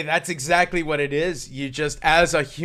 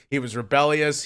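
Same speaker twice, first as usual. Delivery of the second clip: ordinary delivery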